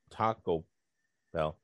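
"Taco", a gap, then "Bell"; low, evenly weighted "Taco... Bell"; pause between words. Retold or imitated. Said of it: "they just did five minutes of Taco".

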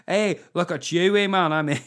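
loud, high-pitched Belfast accent. Shown as a demonstration. Comic trying out Belfast for tour dates, exaggerated Belfast accent. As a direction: have a go at a loud Northern Irish accent. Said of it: "gonna do the Belfast accent".

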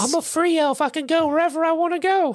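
high squeaky free elf; proud Dobby. Retold or imitated. Dobby boasting of his freedom in a high squeak.

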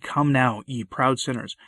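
normal talking voice

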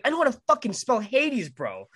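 mock-furious, high-pitched comeback; exasperated retort. Retold angry retort to friend.

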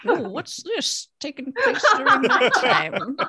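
put-on creaky old-timer; old person voice. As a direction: play a confused old person. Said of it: "Anytime that you pretend to be old".

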